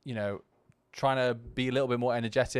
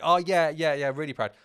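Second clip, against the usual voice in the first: put-on eager kid reply; 4 semitones higher, 3 dB louder than usual. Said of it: "it's kinda like".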